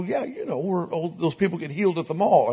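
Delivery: eager visitor explaining the mall outreach. Retold or imitated. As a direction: play the keen newcomer explaining. Mimicking visitors explaining to him.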